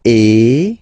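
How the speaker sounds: loud closed e, lips spread; closed French é. Shown as a demonstration. Teacher comparing é with è for students. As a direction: say é tight and bright. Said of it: "The first one is".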